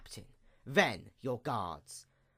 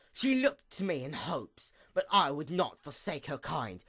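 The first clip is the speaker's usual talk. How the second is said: ordinary talk, nothing marked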